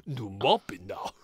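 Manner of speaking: slow, low, gurgling alien voice; a bad Scottish Jabba the Hutt. Mock Scottish guy sounding like Jabba the Hutt. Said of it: "He's kinda like Jabba the Hutt".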